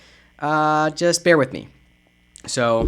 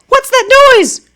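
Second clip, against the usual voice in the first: much higher, louder startled question; 5 dB louder, slower than usual. Acted. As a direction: jump at the noise. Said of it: "I'm doing different voices for different people".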